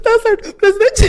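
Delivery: very high, loud, teary squeak; hammed-up tearful agreement; laughing through mock tears. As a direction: choke it out half crying, half laughing. Reenacting swallowing tears.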